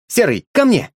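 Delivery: high call, voice carried to the dog. A narrator's reading. Boy calls the dog to come, high call.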